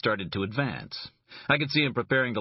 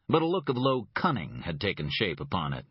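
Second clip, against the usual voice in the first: ordinary delivery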